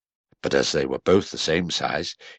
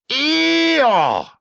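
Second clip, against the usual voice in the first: very high, loud shout; astonished cry; 6 dB louder than usual. A narrator's reading. Friends seeing Eeyore float by, astonished cry. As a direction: cry out all together. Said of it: "cried everybody".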